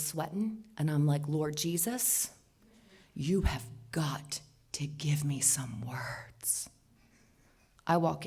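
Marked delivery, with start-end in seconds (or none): 1.3-6.7 slow hushed plea to God; slow quiet prayer under her breath; whispered slowly, pleading. Retold or imitated. Praying before confronting him.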